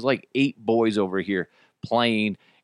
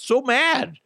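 mad. Real, not acted. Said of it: "that made me mad".

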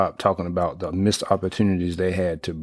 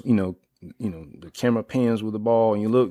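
usual speaking voice

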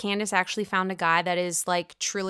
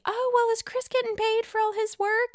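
very high put-on nosy question; mock Mia; 11 semitones higher than usual. Mia asking Dorothy about pay, high mock voice. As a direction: imitate a prying guest in a high voice. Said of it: "She asks Dorothy".

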